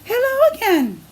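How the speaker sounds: high cheery hello, then falling; greeting dog voice. Acted. Reading to a child, the dog greets her friend again, high and cheery.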